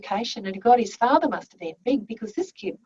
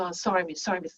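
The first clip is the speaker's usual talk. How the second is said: low, soft imitated apology; upset apology; 5 semitones lower, 9 dB quieter, faster than usual. Upset boy apologises to his teacher, subdued. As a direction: murmur it as an upset student. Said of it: "he sort of got really upset".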